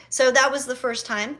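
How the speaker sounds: ordinary talk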